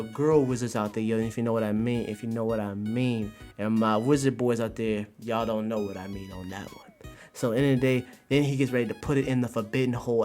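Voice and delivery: ordinary talk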